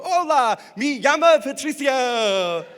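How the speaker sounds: hammed-up gringo Spanish. Patricio greeting his Spanish teacher in hammed-up American-accent Spanish. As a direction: overdo the gringo Spanish proudly. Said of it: "the Jimmy Fallon School of Spanish".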